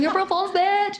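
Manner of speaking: high-pitched mock gotcha; gleeful prank shout. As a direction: crow it like a prankster. Retelling her prank shout.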